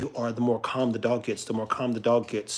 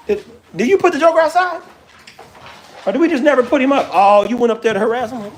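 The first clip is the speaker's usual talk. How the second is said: calling to the dog; 12 semitones higher, 11 dB louder than usual. Real, not acted. Loud high scolding of the dog.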